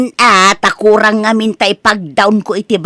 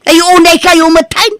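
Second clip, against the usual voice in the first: put-on loud, high-pitched scolding of the children; exasperated scolding; 9 semitones higher, 5 dB louder than usual. Mother scolds her children for not cooperating, loudly exasperated. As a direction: raise your voice, fed up with them.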